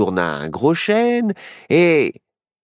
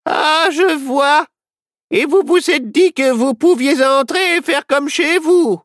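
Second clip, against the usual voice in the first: grumbling; 4 dB louder than usual. Acted. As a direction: scold the guests. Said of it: "maugréa la vieille dame". (vieille dame qui ronchonne)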